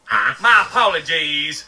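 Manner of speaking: normal talking voice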